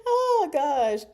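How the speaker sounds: high, loud laughing oh gosh; laughing exclaim. Real, not acted. Reader laughs at the grim joke, loud and high. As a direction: burst out amused.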